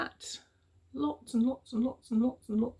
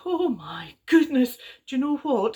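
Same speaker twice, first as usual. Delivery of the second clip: shocked friend; 4 semitones higher, 4 dB louder than usual. Acted. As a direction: draw out the shock.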